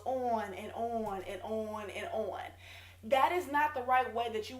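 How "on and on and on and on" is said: slow, repetitive sing-out of "on and on"; droning on. Real, not acted. Coach dismissing the long pitch, droning on. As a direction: drag it out to show endlessness.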